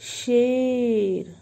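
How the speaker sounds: शेर drawn out very slowly and loudly; slow model reading. Shown as a demonstration. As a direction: stretch शेर for children to repeat. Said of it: "एक साथ पढ़ेंगे बच्चों इसे".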